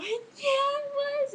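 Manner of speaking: high squeaky laughing through the words; high pitched giggly insistence; laughing squeal. Retold or imitated. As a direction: crack up while insisting. Laughing fit retold.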